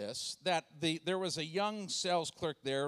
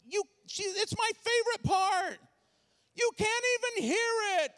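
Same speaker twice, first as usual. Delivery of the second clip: put-on high, loud kid complaint; whiny daughter; 5 dB louder than usual. Daughter complaining to dad about the movie, high whine. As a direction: whine like a girl missing her movie.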